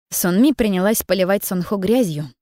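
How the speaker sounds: usual speaking voice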